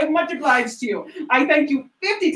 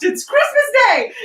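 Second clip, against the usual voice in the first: joyful exclaim; 9 semitones higher, 4 dB louder than usual. Acted. Excited dressing.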